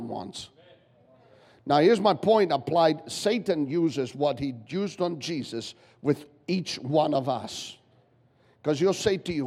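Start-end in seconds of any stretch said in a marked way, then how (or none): none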